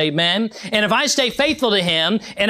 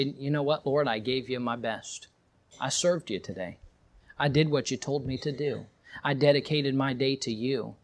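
hushed prayer; 5 semitones lower, 10 dB quieter than usual. Acted. Quiet night prayer.

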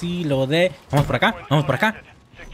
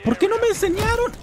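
very high playful voice; mock high whine. Gamer joking about the game, squeaky high whine.

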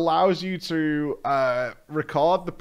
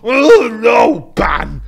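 loud, smug Reddit moderator voice; Reddit mod voice; 5 semitones higher, 12 dB louder, much slower than usual. Pretend. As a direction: play a smug forum mod banning someone. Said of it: "That's my Reddit mod impression".

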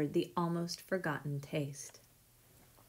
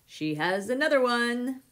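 excited holler, louder and higher; 4 semitones higher, 6 dB louder than usual. A narrator's reading. Postman arriving with a box for Katya, hollering. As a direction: call it out down the street. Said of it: "he hollered".

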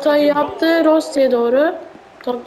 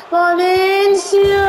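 excited drawn-out call; 4 semitones higher, slower than usual. Real, not acted. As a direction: stretch the name out, rising with excitement. Stretched call as he shoots.

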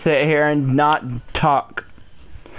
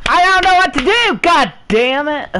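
fast, much higher and louder outburst; angry shout; 7 semitones higher, 4 dB louder than usual. Real, not acted. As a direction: blow up at the game in real frustration. Stuck player loses temper.

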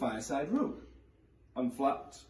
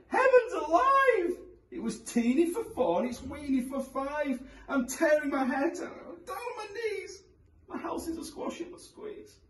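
put-on high, sobbing old woman; wailing old lady. A narrator's reading. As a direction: wail it like a despairing granny. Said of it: "The little old lady cried".

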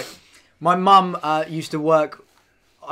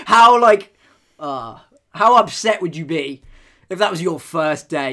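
ordinary delivery

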